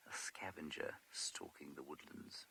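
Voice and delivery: dramatic hushed whisper. Real, not acted. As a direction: whisper it like a teaser. Teasing next sighting.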